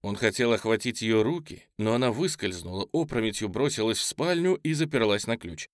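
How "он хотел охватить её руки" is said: ordinary delivery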